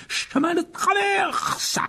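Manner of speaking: very high, slow, drawn-out cry; calling out. Acted. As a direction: shout the destination into the flames. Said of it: "en criant". (crié, très étiré)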